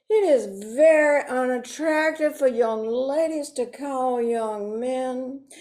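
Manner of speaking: ordinary talk, nothing marked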